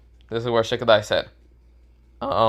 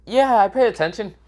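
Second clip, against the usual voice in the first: defensive; 11 semitones higher than usual. Acted. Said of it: "Baruto says".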